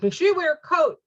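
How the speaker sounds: hammed-up worried wife; fussing mother. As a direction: imitate herself fussing over her husband. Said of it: "I'm like".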